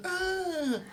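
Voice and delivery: high mock yelp of pain; imitating a kid's yelp off the gate. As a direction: squeak it out like a hurt kid. Kid bounced off gate, cries out.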